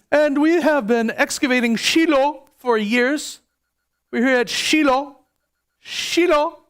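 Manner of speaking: authoritative British tour guide, pitched high; high, declaiming mock-British guide voice; British accent. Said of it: "he had a British accent".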